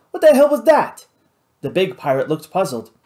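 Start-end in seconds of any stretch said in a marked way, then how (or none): 0.1-1.1 loud, high-pitched demand. A narrator's reading. Louis questioning Marcel about the blast, demanding. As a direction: demand an explanation at volume. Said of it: "he demanded".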